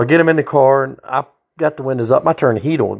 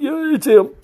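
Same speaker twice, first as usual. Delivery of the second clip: much higher, pinched yep yep; muttered prisoner voice. Retold or imitated. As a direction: mumble it without moving your head.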